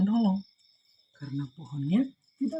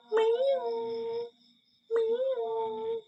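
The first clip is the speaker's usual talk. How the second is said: high squeaky kitten meow; cat meow. Acted. Narrator voicing the trapped cat, high kitten meow. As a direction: mew like a small stuck cat.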